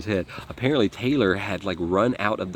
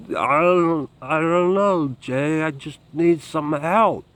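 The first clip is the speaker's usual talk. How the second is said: higher, slow, woozy pleading; dazed drugged plea; 8 semitones higher, 5 dB louder, much slower than usual. Retold or imitated. Friend on speakerphone begging Jay for help, dazed slow higher plea. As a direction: beg for help like you're barely holding on. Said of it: "You can hear it in his voice".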